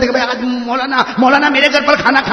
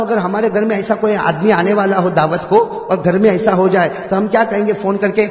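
usual speaking voice

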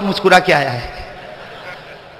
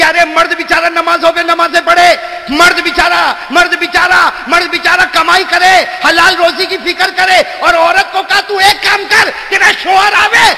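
raised, pleading sermon cry; fervent preaching; 7 semitones higher than usual. Acted. Preacher defends the poor husband, fervent raised voice. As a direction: press the point home to the crowd.

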